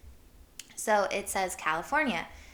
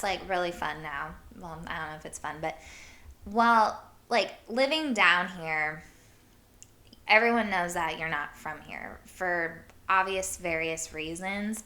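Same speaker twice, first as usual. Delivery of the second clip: ordinary talk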